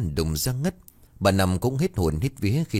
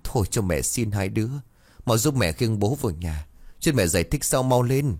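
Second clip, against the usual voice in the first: ordinary delivery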